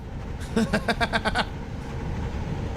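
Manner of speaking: quick breathy chuckles; short, real laugh; amused chuckle. Real, not acted. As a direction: laugh at your own silly name idea. Laughs at Little Booger name.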